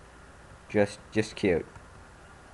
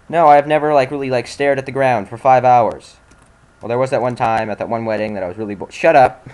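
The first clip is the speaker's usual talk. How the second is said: loud embarrassed protest; 7 dB louder than usual. Real, not acted. Gamer embarrassed by own story.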